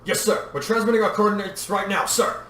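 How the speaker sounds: trooper's sharp report. A narrator's reading. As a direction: answer a commander sharply. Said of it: "clone trooper at the helm said sharply".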